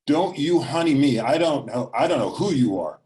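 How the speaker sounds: loud, high angry retort; furious, loud mother. A narrator's reading. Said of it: "but just super loud".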